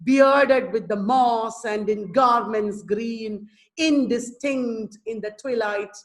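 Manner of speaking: "Bearded with the moss" very slow, BEARD- stressed; rhythmic, like speaking. Shown as a demonstration. Teacher reciting hexameter, slow spoken rhythm. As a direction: draw the beats out slowly without singing. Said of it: "there is rhythm, but it is more like speaking".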